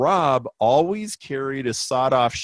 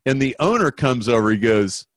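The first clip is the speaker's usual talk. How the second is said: usual speaking voice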